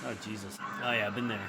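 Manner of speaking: usual speaking voice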